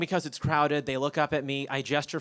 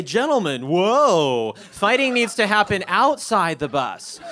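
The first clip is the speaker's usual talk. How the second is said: loud, drawn-out intervention; big imitated referee's halt; calling a halt; 4 semitones higher, 8 dB louder, slower than usual. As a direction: call over the fighters, stretching the key words. Breaking up a fight on bus.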